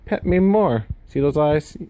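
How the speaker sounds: high, pleading dog voice; voicing the dog. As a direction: plead high like a needy pet. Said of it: "she'll like".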